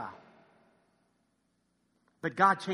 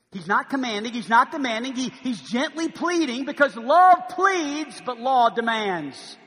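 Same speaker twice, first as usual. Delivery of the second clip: rising preacher's force, slow and louder; preaching; 4 semitones higher, 8 dB louder, slower than usual. Acted. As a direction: build it up like a pulpit appeal.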